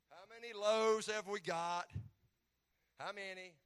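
loud, slow, drawn-out sarcastic question; a little sarcasm. Acted. Preacher reading Jesus's question about the loaves, mildly sarcastic. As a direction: ask it loudly with a touch of sarcasm. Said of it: "read it with a little bit of, of sarcasm".

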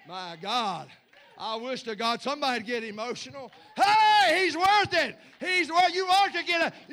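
loud, high, driving preacher's shout; fiery preacher, loud and high, drawn out; preaching at full force. Acted. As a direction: build the sermon to a roar.

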